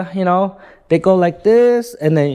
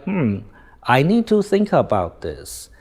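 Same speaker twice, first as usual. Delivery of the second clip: slow, softer, pondering reply; slow talker; 2 semitones lower, 4 dB quieter than usual. Shown as a demonstration. Slow-talking customer weighing a sales pitch, slowly. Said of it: "but you are a slow talker".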